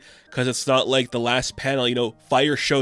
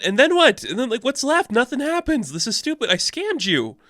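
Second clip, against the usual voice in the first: mock lashing out; 9 semitones higher than usual. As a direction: play the scorned woman ranting up high. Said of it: "Ice has her lashing out moment".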